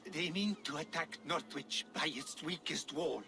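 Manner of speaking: ordinary delivery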